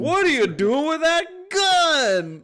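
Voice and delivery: very high, slow, laughing tough guy; hammed-up wiseguy, cracking up; greaser tough guy. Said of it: "imitating the actor's line".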